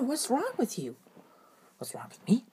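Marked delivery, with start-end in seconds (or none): 1.8-2.4 creepy whispered reply. Acted. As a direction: hiss it back under your breath. Sparrow answers back.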